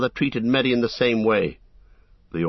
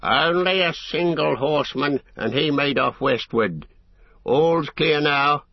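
orc scout; 6 semitones higher than usual. Acted. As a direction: report like a wary orc scout.

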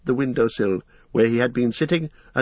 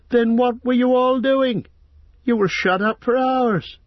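indignant outcry; 12 semitones higher, 5 dB louder than usual. Acted. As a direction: demand it, a young hobbit indignant. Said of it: "cried Pippin".

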